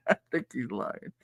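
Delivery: said through a laugh; teasing a friend while laughing. Real, not acted. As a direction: giggle it out. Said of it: "teasing a friend".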